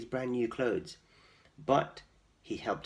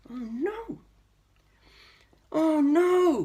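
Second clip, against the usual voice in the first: high, rising wail of dismay; crying out; 7 dB louder, much slower than usual. Acted. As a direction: cry out in despair. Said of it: "cried George".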